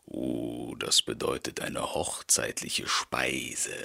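low hushed wolf whisper; the wolf whispers his hope to himself; greedy wolf, low and hushed. A narrator's reading. Wolf voiced by narrator.

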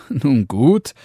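lightly voiced amused father. A narrator's reading. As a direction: give in with amusement. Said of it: "Da lachte der Vater und sprach".

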